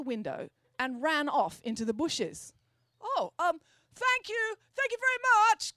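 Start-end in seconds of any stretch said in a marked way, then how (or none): 3.0-5.8 high, loud nervous call after her; calling out thanks. A narrator's reading. Parker calls after Friday, high and loud. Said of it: "called Parker".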